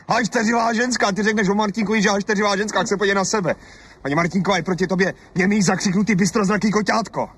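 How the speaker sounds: jeering at her quarrelsomeness; scornful tirade. Acted.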